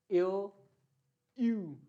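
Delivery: ओ as "yo" and यू as "iu", a quick ee gliding in; isolated, emphatic ओ and यू with rounded glide; umlaut sounds. Shown as a demonstration. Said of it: "इनकी जो sound है वो होती है".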